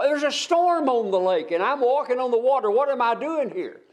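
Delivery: frightened Peter. Acted. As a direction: play Peter suddenly realising he's walking on water.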